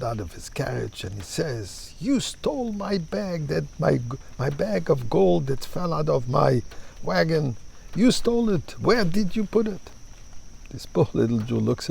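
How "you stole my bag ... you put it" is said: high-pitched accusing baron, not shouted; lightly acted indignant demand; mock accusation. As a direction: accuse him in a raised pitch, storytelling style. Baron accuses the poor man.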